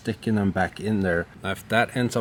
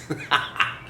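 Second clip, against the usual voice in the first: short voiceless chuckle, pitched up; amused laugh; 6 semitones higher than usual. Real, not acted. Man wearing his wife's reading glasses, amused chuckle. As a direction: laugh briefly at yourself.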